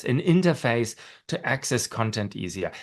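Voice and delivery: ordinary talk, nothing marked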